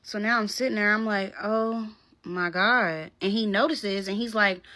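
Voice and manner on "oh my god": drawn-out, dazed disbelief; slow stunned oh my God. Retold or imitated. To herself, watching him, slow stunned disbelief. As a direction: let it sink in slowly.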